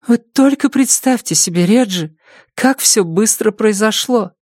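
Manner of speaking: high, anxious woman's voice; an overwhelmed mother pouring it out; distraught mother. A narrator's reading. (встревоженная мать, высоким голосом)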